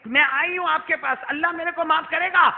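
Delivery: high, loud imploring cry; desperate plea. Retold or imitated. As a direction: plead for forgiveness at a rising pitch. Woman pleads for forgiveness, acted.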